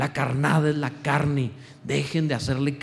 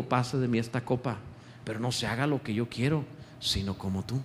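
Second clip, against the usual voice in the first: lower, soft surrendered prayer; praying softly; 5 semitones lower, 7 dB quieter than usual. Acted. Preacher voicing Jesus praying in surrender, low and soft. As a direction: quietly give the cup back to God. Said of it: "Jesús un día oró y dijo".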